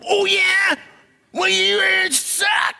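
angry shout. Retold or imitated. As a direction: yell back in a petty fight. Quarrel caught in moment.

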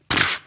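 short puff of air through the lips; scoffing little "pfft"; dismissive pfft. Acted. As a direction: brush it off with a pfft. Waves away any worry.

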